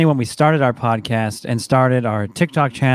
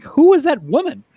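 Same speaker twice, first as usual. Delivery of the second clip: put-on much higher, incredulous kid; mock bewilderment; 12 semitones higher than usual. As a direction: pretend to be baffled by mom. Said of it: "I'm like".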